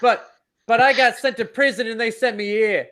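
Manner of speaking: a bad British accent drifting toward Boston; mock Aussie convict, accent slipping; sliding accent. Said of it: "was that a little Boston?".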